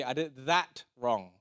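"that" picked out, "wrong" falling away after it; stress on THAT. Shown as a demonstration. Preacher leading the congregation to repeat, stress on THAT. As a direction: put the weight on "that". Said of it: "got the emphasis".

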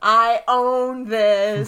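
very slow, stretched-out boast; smug triumph. Real, not acted. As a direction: draw every word out, owning the moment. Gloats over a winning roll.

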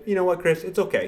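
a hint of the wife, gently forgiving; mimicking his wife. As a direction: lightly play the wife, voice lifted high. Wife's surprising calm reply retold.

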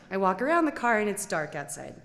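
normal talking voice

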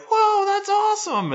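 put-on squeaky high little-kid voice; mock kids cheering. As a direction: pitch way up like excited children.

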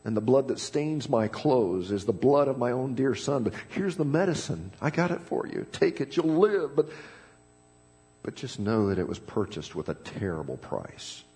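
a hint of tears in a father's voice; understated weeping doctor; tearful doctor. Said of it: "And with tears".

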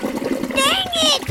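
high startled dang it; frustrated exclamation. Pretend. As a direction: yelp of annoyance. Lost fidget spinner, high.

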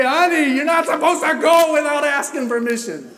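high, loud pretend parental telling-off; mock scolding. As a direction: scold in hammed-up exasperation.

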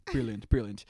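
a lightly put-on British accent, low; mock-posh British "brilliant"; hammy British impression of the ad guy; bad British accent. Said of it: "that's my horrible".

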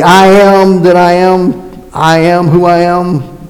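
slow, sustained declaration; solemn intoning. Acted. Preacher declaring the name of God, solemn intoning. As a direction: intone the divine name slowly and weightily.

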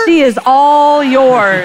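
drawn-out "she is all yours". Retold or imitated. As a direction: hand the kid off, slow and pointed. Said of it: "I remember saying as I was passing him".

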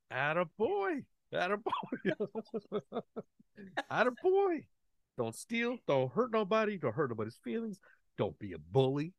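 proud cheering. Retold or imitated. Dad cheering his son's pose.

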